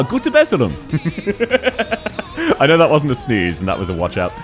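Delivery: usual speaking voice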